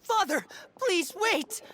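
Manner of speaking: high, drawn-out call; urgent plea. Acted. Child begging Father to halt the punishment, urgently pleading. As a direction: cry out to halt them.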